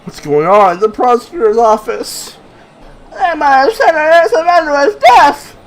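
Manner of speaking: high, loud and slow outcry; shocked shout. Acted. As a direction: cry out in disbelief. Lawyer protesting in court.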